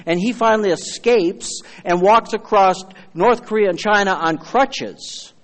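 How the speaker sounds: ordinary talk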